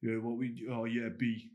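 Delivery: mock the mumbling cool kid, words half swallowed; mocking a guy who swallows his words to sound cool. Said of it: "modern guys who mumbles their words".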